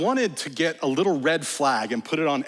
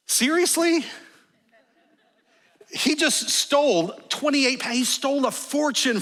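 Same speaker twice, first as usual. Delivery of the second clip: incredulous; 10 semitones higher than usual. Real, not acted. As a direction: sound stunned that she'd say that. Preacher reacts to mother's blessing.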